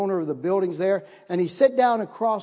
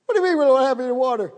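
high, fast, frantic cry of panic; panicked outburst; 7 semitones higher, 5 dB louder, much faster than usual. Retold or imitated. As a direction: blurt it out in rising panic, louder. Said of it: "I fell apart".

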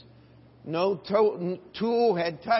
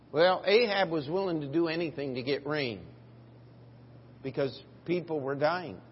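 ordinary talk

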